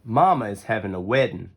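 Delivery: plain reading voice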